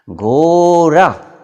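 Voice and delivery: very drawn-out, loud, high घोरा with R for ḍ; teacher demonstrating wrongly. Acted. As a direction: stretch घोरा hugely to correct the class. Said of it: "ऐसा नहीं बोलना है". (Master का बहुत खिंचा, ज़ोरदार घोरा, ड़ की जगह र)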